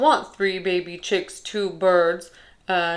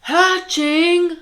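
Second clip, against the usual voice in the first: shocked; 6 semitones higher, 5 dB louder than usual. Acted. Gerald shocked by hatching news.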